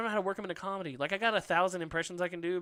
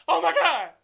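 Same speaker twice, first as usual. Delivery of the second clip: high, loud excited shout; Eddie Murphy yell; 12 semitones higher, 10 dB louder than usual. Retold or imitated. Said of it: "I can only do Eddie Murphy if I'm yelling".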